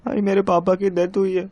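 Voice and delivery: high, tearful voice; crying. Acted. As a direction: break the news of a death while crying. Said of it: "तू रो क्यों रहा है?". (रोते हुए, ऊँचे सुर में)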